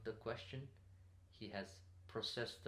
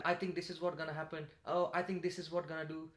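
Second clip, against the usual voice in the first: high, loud and fast, every line lifting up; high pitch every sentence; 7 semitones higher, 6 dB louder than usual. Shown as a demonstration. Speaker mocking people who talk in high pitch, too high and fast. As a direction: sound unsure, like each statement is a question. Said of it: "talking with a very high pitch at every sentence".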